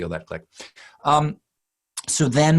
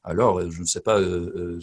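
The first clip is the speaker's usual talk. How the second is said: murmured French filler with rounded "euh"; French hesitation; 2 semitones lower than usual. Shown as a demonstration. Accent coach showing how French speakers fill pauses, French hesitation. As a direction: hesitate in French, searching for words. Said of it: "If it's French".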